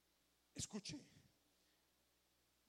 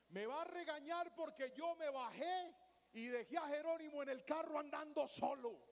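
higher, louder panicky inner voice; worried thought; 7 semitones higher, 5 dB louder than usual. Retold or imitated. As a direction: dread of being scolded by his wife. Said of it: "qué fue lo primero que pensé".

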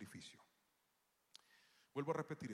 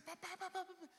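put-on child calling. Daughter voiced in story.

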